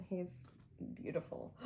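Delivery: breathy hushed admiration, barely voiced; almost whisper it in awe. Real, not acted. Fan whispering over a beautiful photo card, hushed awe.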